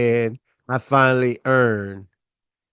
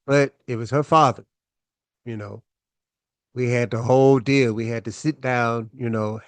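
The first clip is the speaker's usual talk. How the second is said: normal talking voice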